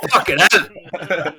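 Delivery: real laughing exasperation; laughing curse. Real, not acted. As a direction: swear while cracking up. Exasperated at the dishes on camera.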